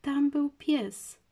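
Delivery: put-on high, slow, distressed little-girl voice; upset child, slow and strained; choked-up child. A narrator's reading. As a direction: draw it out high, throat tight with upset. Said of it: "wyszeptała Basia".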